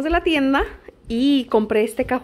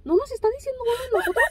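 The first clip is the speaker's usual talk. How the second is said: laughing quote; 12 semitones higher, 3 dB quieter, faster than usual. Retold or imitated. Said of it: "Luego me dijo".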